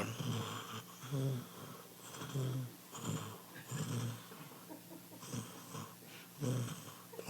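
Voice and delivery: very soft, low "mm-hmm"s; tiny murmured hums with long gaps; imitated listening hums. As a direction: imitate an attentive listener with spaced, barely audible hums. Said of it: "like this".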